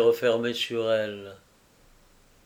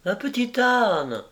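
put-on much higher child's cry of joy; thrilled little boy shouting; child exclaiming over a donkey; delighted cry; 10 semitones higher than usual. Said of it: "s'écriait l'enfant".

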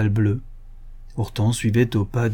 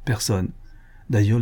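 plain reading voice

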